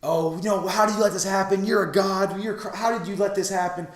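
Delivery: high-pitched mock scolding; Bulma, yelling. Bulma digging into Beerus in an imaginary scene, yelling. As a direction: imitate an outraged woman berating someone. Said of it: "just yelling at him like".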